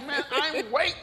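high, loud comic protest; exasperated outburst. Retold or imitated. Man tired of waiting while others have fun, mock-exasperated. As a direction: cry out as if fed up. Acted exasperation at having to wait.

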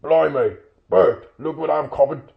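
loud Cockney troll exclamation; astonished troll. Acted. William showing Bert his catch, loud and astonished. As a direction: call out your surprise to a mate.

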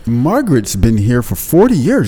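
high, over-the-top cheery manager; mock boss praise. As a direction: gush about trivial work. Boss praising staff ironically.

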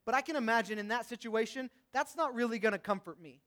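normal talking voice

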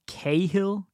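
first "Cahill" as KAY-hill; two full syllables in "Cahill"; common mispronunciation. Shown as a demonstration. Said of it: "names that are mispronounced".

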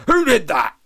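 snorted high, loud outburst; indignant shout after being hit; Freddie Flinders. A narrator's reading. Drinker struck on the back demands culprit, snorting. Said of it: "snorted Flinders".

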